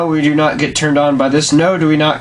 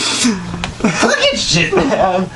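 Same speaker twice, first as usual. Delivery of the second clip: very slow laugh-soaked curse; wheezing out the words; 5 semitones higher, much slower than usual. Real, not acted. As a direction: still laughing through it. Laughing after the song.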